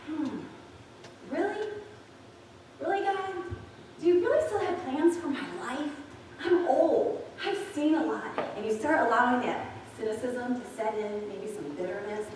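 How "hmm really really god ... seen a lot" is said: high, skeptical questioning; doubting. Retold or imitated. Preacher voicing a listener's high, doubting reply to God. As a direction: ask God in high disbelief. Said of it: "And you're like".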